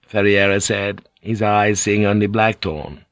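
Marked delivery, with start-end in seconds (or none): none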